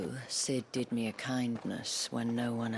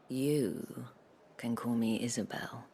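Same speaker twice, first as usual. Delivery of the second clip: breathy, teasing invitation; seductive whisper. Acted. Isabel teasing Clive, breathy and seductive. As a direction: draw him in with a hush. Courtesan flirting.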